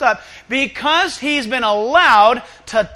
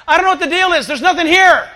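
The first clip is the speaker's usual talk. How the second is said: put-on loud, higher teenage yell; teen yelling back; 6 semitones higher, 6 dB louder, faster than usual. A teenager yelling back to his friends, loud. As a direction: call it out like a careless kid. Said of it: "then yells back to his friends".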